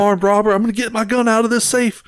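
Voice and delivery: high, mock-menacing warning to a robber; skit homeowner. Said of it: "that Jim Jeffries skit".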